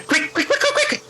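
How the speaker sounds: high-pitched, frantic urging; urgent hurrying. Acted. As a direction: hustle someone through the door, voice pitched high. Gnome hurries visitor through door.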